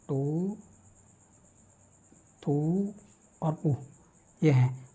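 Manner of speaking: ordinary talk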